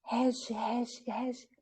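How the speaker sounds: strangled, weak calls, slow and soft; voice won't come out. Retold or imitated. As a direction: imitate calling out when your voice fails you. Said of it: "a minha voz não saía". (voz presa, quase sem sair)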